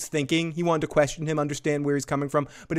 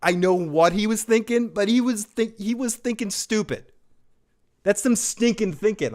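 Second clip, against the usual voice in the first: ordinary delivery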